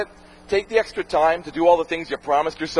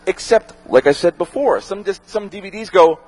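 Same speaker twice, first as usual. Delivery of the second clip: usual speaking voice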